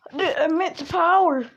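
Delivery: high-pitched amazed exclamation; acted crowd astonishment; awestruck. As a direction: gasp at the power like an onlooker. Onlookers react to transformation.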